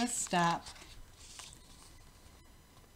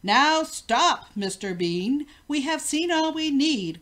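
loud urgent order; commanding cry; 4 semitones higher, 6 dB louder than usual. A narrator's reading. King cries out to Mister Bean to stop, loud. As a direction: yell at him to stop now. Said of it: "cried the king".

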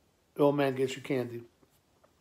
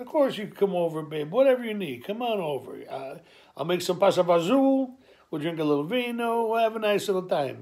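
eager welcome; 5 semitones higher, 4 dB louder than usual. Retold or imitated. Dad eagerly welcomes son.

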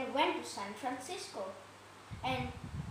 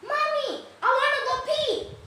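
put-on loud, high kid's yell; screaming kid; 11 semitones higher, 6 dB louder than usual.

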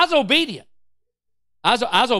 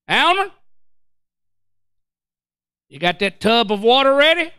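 raised voice to another room; father-in-law hollering for Alma; calling out; 4 dB louder than usual. Retold or imitated. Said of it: "He said".

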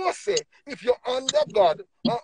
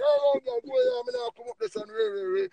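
high, cheeky imitation of a child brushing off mom; mock rude teen; 13 semitones higher than usual. Imitating a child dismissing the mother who calls, mocking high voice. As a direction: mimic a kid mouthing off, pitched up. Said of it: "you say".

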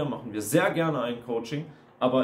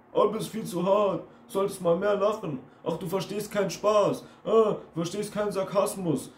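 higher-pitched mocking whine; mocking stock phrases; 6 semitones higher than usual. Coach mimics critics telling him he is too sensitive, mocking. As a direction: parrot their nagging, pitched up.

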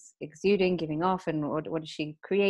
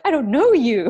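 amused disbelief; 7 semitones higher, 10 dB louder than usual. Retold or imitated. Looking at partner speaking Italian.

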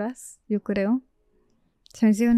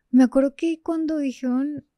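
ordinary talk

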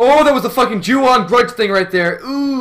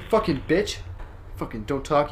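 ordinary talk, nothing marked

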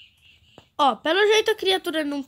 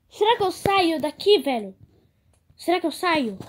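usual speaking voice

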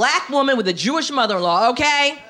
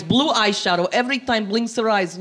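ordinary talk, nothing marked

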